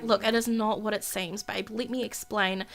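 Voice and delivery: acted tender plea; soothing. She soothes him with a kiss.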